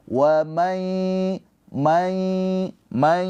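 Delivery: Wa may with the n merged into m and a long nasal hum; nasal ghunnah. Shown as a demonstration. Teacher demonstrates nasal merging to students. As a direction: hum may through the nose. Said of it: "keluar suara dari hidung".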